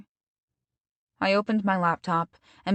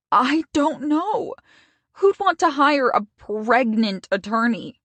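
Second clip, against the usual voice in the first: higher, slow, crying voice; tearful; 6 semitones higher, 5 dB louder than usual. Acted. Stacy crying to her best friend, higher and tearful. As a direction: choke out the words through tears.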